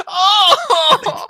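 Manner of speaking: high, loud shocked laughing; horrified laughter. Real, not acted. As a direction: laugh out in disbelief.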